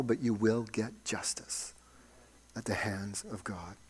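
soft, low pastoral assurance; hushed preaching. Real, not acted. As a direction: drop to a near-whisper to reassure the congregation.

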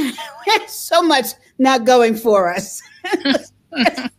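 rueful laugh through the words. Real, not acted. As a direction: laugh it off while talking. Laughing about struggles.

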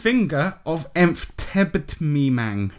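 very slow, careful repeat of the odd name. Shown as a demonstration. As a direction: repeat it slowly for them.

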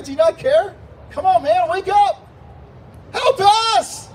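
high, loud plea building to a yell; frantic, going crazy. Acted. Disciples waking Jesus in the storm, frantic shouting. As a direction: escalate from urgent pleading into a full shout. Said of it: "They're going crazy".

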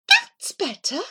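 high catlike satisfied voice; meowed approval. Acted. Cat pleased Abu snapped out of it, meowed. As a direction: say it as a meow. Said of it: "she meowed".

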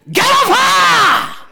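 high, loud, slow rebuke; reenacted scream at the spirit; shouted command. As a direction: yell it at the demon with full force. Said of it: "I shouted on the top of my voice".

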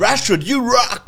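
high, cheesy fan cheer; mock American fan. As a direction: ham up a naive fan. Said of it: "mock American fan".

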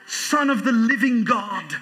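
grand, slow English-accented declaration; hammed-up King James English accent. Shown as a demonstration. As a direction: draw it out dramatically in an English accent. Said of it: "say it with an English accent".

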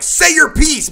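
high, loud, daring yell; shouted challenge. Acted. Singer holding the mic down to the owner, shouted challenge. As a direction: shout it as a dare.

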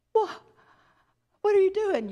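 high, startled cry of disbelief; astonished Job. Acted. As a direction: leap up high in amazement. Job reacts to God's tour.

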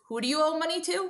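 imitating confused dad. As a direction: lightly mimic a dad who has misunderstood. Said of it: "And he goes".

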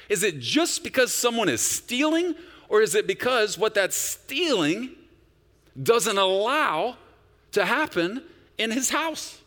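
high and loud, slowing on doesn't allow; rising preaching. Real, not acted. Pastor driving home his point to the church, high and loud. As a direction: build the question to a peak.

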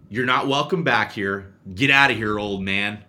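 loud mock ejection of the grandpa; retold ejection. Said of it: "and I said".

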